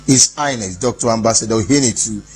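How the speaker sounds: ordinary talk, nothing marked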